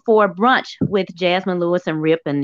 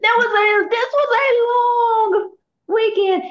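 exaggerated weary whine; 11 semitones higher than usual. Tired cohost complaining about weekend.

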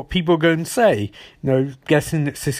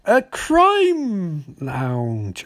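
mock-dramatic; much slower than usual. Real, not acted. Podcaster cuts to the gangsters' scene, mock-dramatic. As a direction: announce it like a grand reveal, stretched out.

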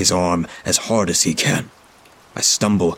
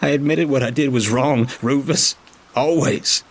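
high, raised accusation; bitter shouted retort; 6 semitones higher than usual. A narrator's reading. Toggs yelling back at Rufus, bitter. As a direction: hurl it back at him.

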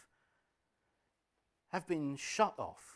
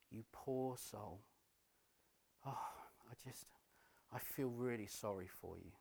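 soothing tempter; 2 semitones lower, 9 dB quieter than usual. Acted. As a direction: coo false sympathy in their ear. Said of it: "he will come along and he will say".

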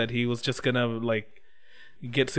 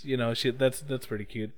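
normal talking voice

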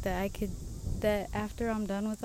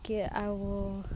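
ordinary talk

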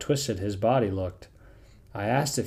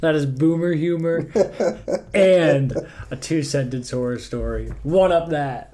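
laughing; 6 semitones higher, 7 dB louder than usual. Real, not acted. Laughing after punchline.

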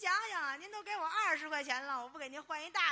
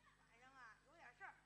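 very soft acted soliloquy; murmured to himself; 19 dB quieter than usual. Visitor heading to Yu's house at night, murmuring to himself. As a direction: think aloud under your breath.

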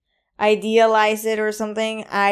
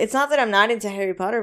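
usual speaking voice

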